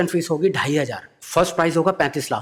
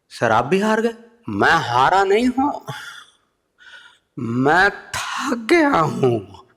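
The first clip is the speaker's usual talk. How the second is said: slow, higher, tired orator voice; mock-weary Modi; 4 semitones higher, much slower than usual. Modi wearily answering the comedian. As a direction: sigh it out like a worn leader. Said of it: "Modi जी क्या बोलते हैं".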